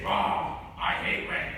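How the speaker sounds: annoyed grumble; grumpy growl. Retold or imitated. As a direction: grumble it like a grouchy parent. Parent complaining about rain.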